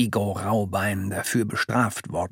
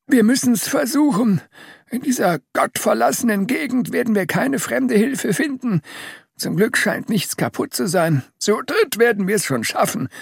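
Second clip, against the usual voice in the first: Papa Dick; 10 semitones higher than usual. A narrator's reading. Papa Dick voiced in story.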